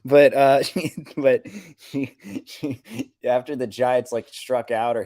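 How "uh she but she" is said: usual speaking voice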